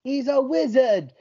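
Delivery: a playful put-on English accent; hammed-up English accent praising his co-host. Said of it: "I'm going heavy with the English accents today".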